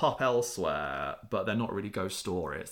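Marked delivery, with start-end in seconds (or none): none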